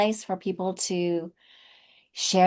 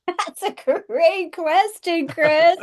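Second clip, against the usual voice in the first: amused exclaim; 12 semitones higher, 5 dB louder than usual. Real, not acted. Laughing at host's question.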